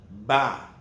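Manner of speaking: "ba" with lips closed, a firm b; strong b. Shown as a demonstration. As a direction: lips together, hard "ba". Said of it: "strong sound".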